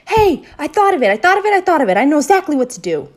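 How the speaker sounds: high, loud shout of sudden inspiration; excited shout. A narrator's reading. Girl suddenly solves the problem, shouting excitedly. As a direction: shout it out, bursting with the idea. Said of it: "I shouted".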